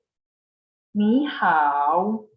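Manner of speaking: 你好 drawn out slowly, each tone clear; slow careful Mandarin. Shown as a demonstration. Teacher to class: slow model reading. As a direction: read 你好 slowly for students to copy. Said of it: "I will read it one more time. Listen carefully".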